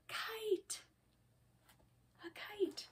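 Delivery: whispery, very soft wonder; almost whisper it like a secret; hushed reveal. A narrator's reading. Hushed reveal of what they built.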